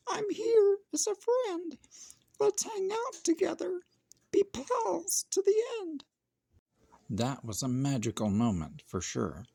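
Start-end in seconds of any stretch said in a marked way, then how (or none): 0.1-6.0 very high squeaky mouse, kind and hopeful; friendly mouse. Acted. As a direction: offer friendship in a tiny voice. Mouse voice in story.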